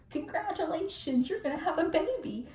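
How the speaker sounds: mock-sweet old man gushing; imitated cheery well-wisher. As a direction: coo it, imitating a delighted stranger. Acts the sweet old janitor.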